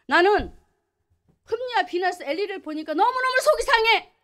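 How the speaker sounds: a grieved God pouring out his heart; God speaking. Acted. Preacher voices God's complaint.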